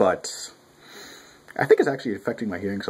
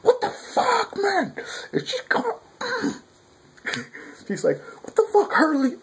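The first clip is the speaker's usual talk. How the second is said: much higher, mock-angry girl rant. Voicing Shiranui's rant at Harley, high and mock-angry. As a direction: rant like a squeaky angry schoolgirl.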